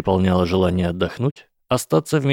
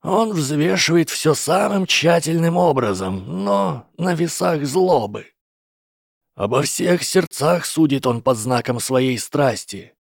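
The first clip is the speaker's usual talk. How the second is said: Gandalf voice; 6 semitones higher than usual. Acted. Gandalf continues speaking.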